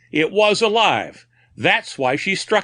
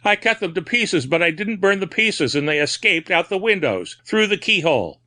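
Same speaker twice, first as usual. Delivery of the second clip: higher, feverish raving about the pieces; breaking out again; 6 semitones higher than usual. A narrator's reading. Madman confessing to the doctor, feverish outburst. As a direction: let the madness spill out again. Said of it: "Then he broke out again".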